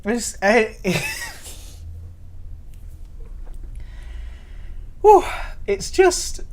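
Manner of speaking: high, broken words between giggles; helpless, breathless real laughter; almost crying with laughter. Real, not acted. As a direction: try to speak through a laughing fit. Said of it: "almost crying with laughter".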